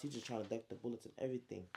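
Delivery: ordinary delivery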